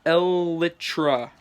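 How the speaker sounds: "Elytra" drawn out slowly; slow, careful "Elytra"; slow pronunciation demo. Shown as a demonstration. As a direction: sound out "Elytra" with a long first syllable. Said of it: "Elytra is pronounced".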